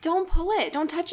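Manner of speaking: real, high-pitched plea to stop; urgent warning. Real, not acted. Friend stops another picking a mole, urgent and alarmed.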